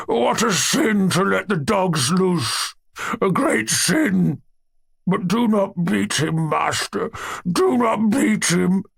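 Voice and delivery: high, slow, faraway wail of a holy fool; muttering holy fool. A narrator's reading. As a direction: drift off into a pious lament, slowly. Grisha the holy fool speaks.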